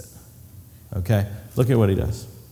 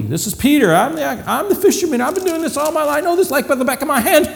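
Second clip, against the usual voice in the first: higher, cocky fisherman bragging; boastful Peter; 11 semitones higher than usual. Acted. Peter boasts to Jesus that he knows fishing, cocky and high. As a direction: puff up like the proud expert.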